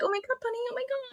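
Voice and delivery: tiny, very high falsetto; mock bunny squeal. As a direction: squeal softly like excited girls behind glass. Mimicking bunnies at the window.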